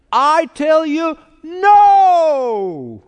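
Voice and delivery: NO shouted high and loud; emphatic no. Shown as a demonstration. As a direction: punch the word "no" as a shout, high above the rest. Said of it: "is in the emphatic position".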